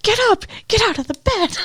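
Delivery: very high, squeaky comic alarm; hammed-up panicked warning; mock frantic urging. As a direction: urge them in pretend desperation, high-pitched. Acts viewer urging characters on screen.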